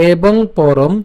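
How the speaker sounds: verse read again slowly, piece by piece; restart, chunk by chunk; careful teaching pace; slow read from the start. Shown as a demonstration. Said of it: "আমি আবার প্রথম থেকে বলি".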